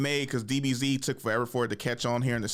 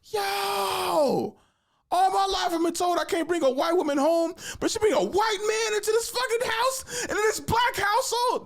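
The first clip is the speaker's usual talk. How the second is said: indignant protest. Retold or imitated. Protesting to mom about sister's boyfriend, high mock outrage. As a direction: whine it like a wronged son. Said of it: "I'm just like".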